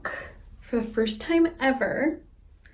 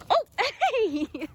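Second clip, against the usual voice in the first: talking to a dog; 10 semitones higher than usual. Real, not acted. Greeting the dog.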